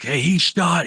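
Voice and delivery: high, loud, urgent protest; 慌张地高声辩解; panicked protest. Retold or imitated. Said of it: "you're like".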